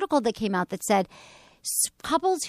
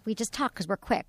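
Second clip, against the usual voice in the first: ordinary delivery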